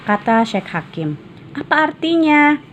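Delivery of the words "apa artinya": put-on high, eager child's question; curious child. Boy asks the sheikh, eager and high.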